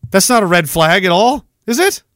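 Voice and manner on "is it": mock surprised. Mock naive questioner.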